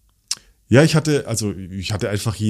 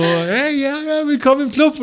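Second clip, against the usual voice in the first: excited high greeting. Retold or imitated. Retold excited reply on plane.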